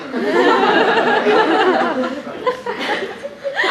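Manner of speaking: long high laugh; laughing at her own impression; loud high laugh. Real, not acted. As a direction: burst out laughing.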